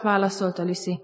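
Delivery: ordinary talk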